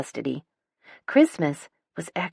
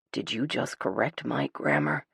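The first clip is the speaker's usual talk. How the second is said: lowered, softer voice in mock disbelief; low whisper; 4 semitones lower, 5 dB quieter than usual. A narrator's reading. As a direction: hush it like a scandal. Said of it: "he whispers lowly".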